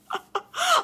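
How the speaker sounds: high burst of laughter. Real, not acted. Wife amused by her husband's huge nightly lunch, high laugh.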